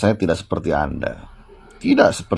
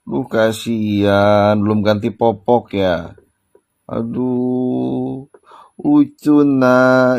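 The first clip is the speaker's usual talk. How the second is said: put-on slow, sing-song baby talk; mock baby talk; 4 semitones higher, much slower than usual. Vlogger teasing lawyer as a baby, cooing. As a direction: talk down to him like a fussy infant.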